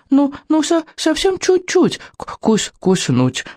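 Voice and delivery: high, stammering, wheedling creature voice; pleading little dragon. A narrator's reading. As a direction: beg in a high voice, stretching and stuttering the words. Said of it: "взмолился Беззубик".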